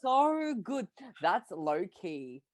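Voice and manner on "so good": thrilled gasping praise; excited. Real, not acted. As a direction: gush over it. Gushing over a scene.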